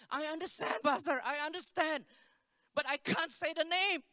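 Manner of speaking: imitating a desperate woman, high and insistent; distressed pleading. Girl tells pastor she can't say it, retold desperate pleading.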